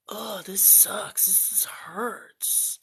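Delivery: breathy whisper, slow. Retold or imitated. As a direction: whisper it slowly like it hurts. Retold reaction to chugging drink.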